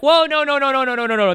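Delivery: high, loud panicked whoa, no no no; hammed-up flustered backpedal; imitating a rival who is caught out, high and loud; mocking opponent. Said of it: "He was like".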